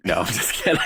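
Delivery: ordinary talk, nothing marked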